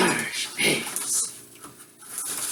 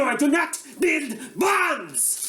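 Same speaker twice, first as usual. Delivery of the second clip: mock shout; 7 semitones higher, slower than usual. Denying bomb building.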